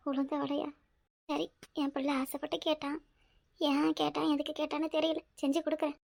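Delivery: very soft, weary consent; resigned. Acted. Mother agreeing to cook for her son, resigned. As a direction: give in quietly, with no energy left. Gives in to son's food wish.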